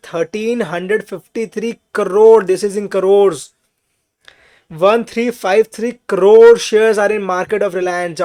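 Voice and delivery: loud, very slow, each number spelled out; slow emphatic dictation. Shown as a demonstration. Teacher drilling the share count into students, loud and slow. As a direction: hammer the figure home for the class. Teacher stressing key figure to class.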